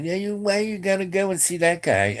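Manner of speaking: high-pitched imitation of nagging; mock nagging woman. Tired girls object to his evening out, mock nagging in high pitch. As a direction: put on a high, complaining woman's voice. Girls nag him about going out.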